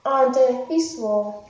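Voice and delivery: plain reading voice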